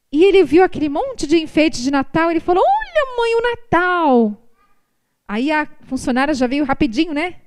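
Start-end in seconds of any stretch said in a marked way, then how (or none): 2.6-4.5 put-on high, delighted kid's cry; mock excited child voice. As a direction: squeal it like a thrilled little boy.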